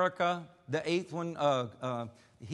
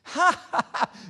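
high, mocking burst of laughter; drunk's laugh; 11 semitones higher, 4 dB louder than usual. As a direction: laugh out loud at her like a drunk. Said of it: "and he starts laughing".

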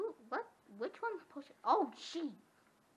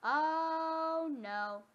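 higher, louder drawn-out dismay; dismayed wail; 4 semitones higher, 7 dB louder than usual. Real, not acted. Gamer watching wolves in danger, drawn-out dismayed wail. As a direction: let the "no" stretch in a high wail.

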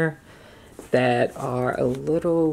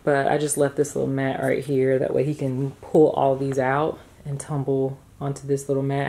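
ordinary delivery

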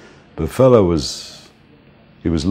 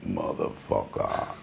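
much lower, softer, slow muttered curse; 12 semitones lower, 14 dB quieter, slower than usual. Retold or imitated. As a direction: growl it under the breath at the cop. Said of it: "And [name] would say".

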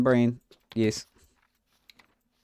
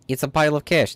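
usual speaking voice

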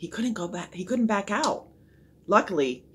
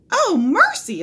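high, loud outcry of alarm; startled cry; 10 dB louder, slower than usual. A narrator's reading. Woman exclaims over the caught boy, crying out. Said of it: "a woman cried".

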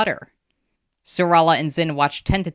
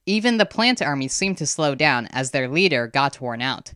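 ordinary delivery, nothing marked

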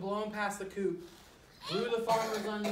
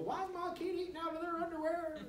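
higher, fast mock parent complaint; worried parent; 9 semitones higher, 4 dB quieter, faster than usual. Author imitating a parent calling school, high and fast. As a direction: squeak it like a baffled parent. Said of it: "I don't want anyone calling the school".

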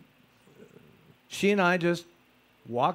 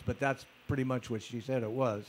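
normal talking voice

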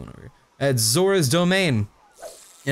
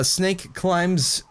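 ordinary talk, nothing marked

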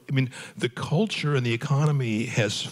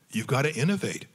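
ordinary talk

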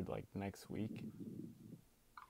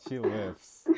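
louder, punchy insistence; loud emphatic; 6 dB louder than usual. Real, not acted. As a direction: hit it hard, insisting. Insisting Michelle Obama lifts.

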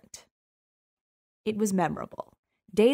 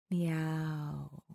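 whispered; 2 semitones lower, 8 dB quieter than usual. Acted. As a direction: lean in close and breathe the meow. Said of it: "I whispered".

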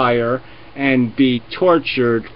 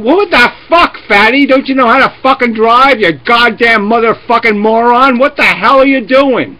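much higher, louder frantic road-rage screaming; hysterical yelling; 7 semitones higher, 6 dB louder than usual. Retold or imitated. Passenger screaming at a reckless bus driver, hysterical. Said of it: "you'd be hysterically saying".

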